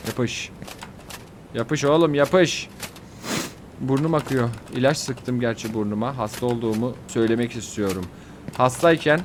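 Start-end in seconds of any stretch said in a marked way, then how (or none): none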